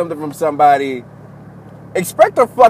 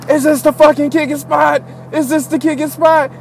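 mock yelling; 9 semitones higher than usual. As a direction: mimic a yell, pitch way up. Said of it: "he's just yelling".

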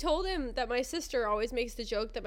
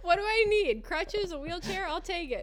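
high-pitched mock urgency; acted eagerness, voice lifted high; eager customer; 7 semitones higher than usual. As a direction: play a buyer sold on anything. Eager buyer in joke sales pitch.

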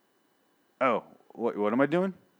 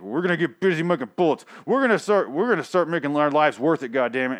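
much higher, louder, put-on rallying voice; hammed-up Eugene giving a pep talk; higher, louder mock battle cry about bullets; imitating Eugene; 6 semitones higher, 4 dB louder than usual. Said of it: "he's like".

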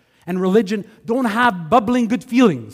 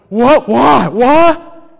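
loud, rising, stammered whats; stunned disbelief; 4 semitones higher, 10 dB louder, much slower than usual. Retold or imitated. Friends sputtering loudly at the pastor's choice. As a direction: sputter in total shock.

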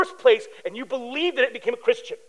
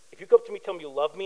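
usual speaking voice

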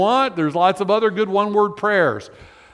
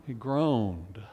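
hushed; 6 semitones lower, 10 dB quieter than usual. A narrator's reading. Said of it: "groan described".

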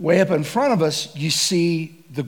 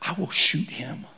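unvoiced, much softer grim whisper; whispered resolve; 6 dB quieter than usual. Hunter bracing to shoot, grim whisper. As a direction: whisper it like a hunter ready to fire.

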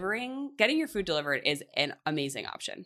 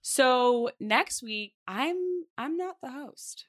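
higher, drawn-out mock hosting; playful announcer; 7 semitones higher, much slower than usual. Host flubs next week's handover, playful singsong. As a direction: play at announcing next week.

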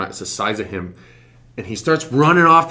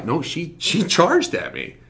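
ordinary talk

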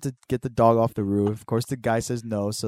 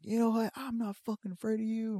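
high mock yell; 11 semitones higher, 6 dB quieter than usual. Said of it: "he's just yelling shit".

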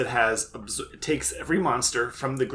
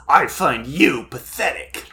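slow, louder, contemptuous; cold Kaiba sneer; 6 dB louder than usual. Retold or imitated. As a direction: look down on him slowly. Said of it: "Kaiba says".

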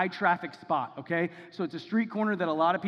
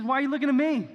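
higher and louder, taken aback; incredulous question; 9 semitones higher, 6 dB louder, faster than usual. Retold or imitated. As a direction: throw the question back at the staring crowd. Peter to staring crowd.

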